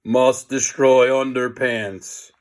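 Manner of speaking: slow, droning zombie chant; zombie groan. A narrator's reading. Zombie nerds close in, slow groaning chant. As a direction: drone it like a mindless zombie. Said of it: "they groaned".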